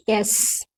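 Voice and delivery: "यस" said as ess, no y; correct letter S. Shown as a demonstration. Teacher modelling S for pupils, correct reading. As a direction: open "यस" on a clean e, long hiss. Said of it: "यस और एस में अंतर है ना".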